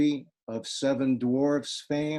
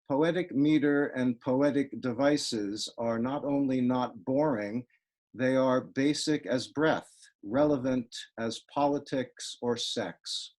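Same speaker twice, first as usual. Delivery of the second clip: ordinary talk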